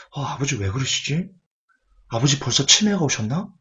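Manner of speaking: hushed mock bewilderment; puzzled aside. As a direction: imitate children quietly doubting their father. Children privately puzzled by father.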